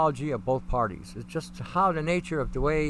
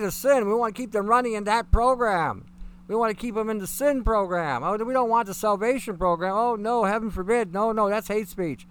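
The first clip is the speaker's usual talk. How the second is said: louder, higher sarcastic mimicry of preachers; sarcastic mockery; 4 semitones higher, 5 dB louder than usual. Mocking churches that won't offend sinners, sarcastic. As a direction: sneer it as if quoting hypocrites.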